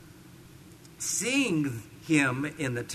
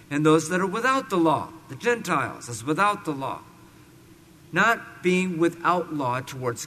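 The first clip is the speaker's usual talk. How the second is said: loud, measured Bible reading; scripture reading; 5 dB louder than usual. Acted. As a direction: proclaim the verses firmly to a congregation. Reads scripture aloud to congregation.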